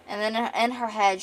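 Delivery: usual speaking voice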